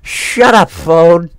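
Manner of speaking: loud high yell at the phone; yelled in real fright; startled yell. Real, not acted. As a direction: snap out a startled shout. Jump scare, yelling at phone.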